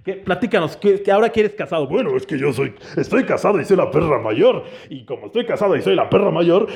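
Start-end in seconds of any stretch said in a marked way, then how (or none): 1.9-6.7 low lazy buddy voice; lightly voiced friend, a bit lower; a hint of a laid-back married buddy; voicing friend La Perra Mayor. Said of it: "Paola es su esposa".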